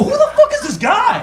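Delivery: high, incredulous mock outburst; acted outraged squawk; indignant disbelief. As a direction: blurt it out as a baffled crowd member. Acts everyone's outraged reaction.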